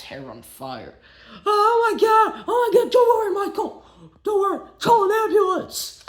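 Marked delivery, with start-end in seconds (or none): 1.4-6.0 high, loud panicked yelling; panicked shout. Acted. Rehearsal crew seeing Michael's hair burn, panicked high shouts. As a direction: yell for help in a panic.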